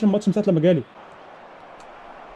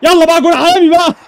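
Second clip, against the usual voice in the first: excited cheer; 8 semitones higher, 5 dB louder than usual. Real, not acted. Cheering a goal in the game.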